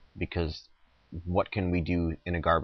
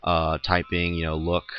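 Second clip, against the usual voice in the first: usual speaking voice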